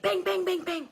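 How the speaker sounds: sharp mock knocks, banging out the rhythm; imitated door banging. Voicing the police pounding on the door at night, mock banging. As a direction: bang it out like fists pounding the door. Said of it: "like, banging, like".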